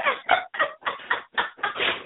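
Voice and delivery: high-pitched amused giggle; breathy laugh up high. Real, not acted. Laughs at Fran Drescher claim.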